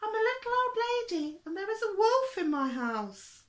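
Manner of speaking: put-on very high, loud little old lady voice; crying for help. A narrator's reading. Little old lady phoning the fire brigade, crying wolf in a high voice. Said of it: "she cried".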